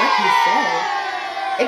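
stretched-out, loud mock outrage; mocking holler, drawn out; incredulous shout. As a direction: repeat the question in a slow, disbelieving yell. Incredulous repeat of mom's question.

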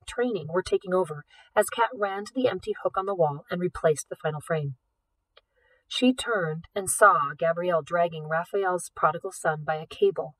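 usual speaking voice

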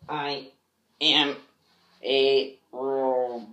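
I, am, a robot said slowly word by word. Acted. Kid playing robot, slowly spacing out words. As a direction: drag each robot word out. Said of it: "robot role".

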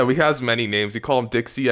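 ordinary talk, nothing marked

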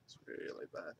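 embarrassed whisper. Real, not acted. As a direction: whisper it to yourself. Embarrassed about bad drawing.

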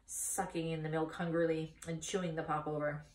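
usual speaking voice

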